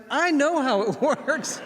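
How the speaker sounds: high, slow, laughing through the words; laughing insistence. Retold or imitated. Wife laughing as she insists she knows how it works. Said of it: "And she's like".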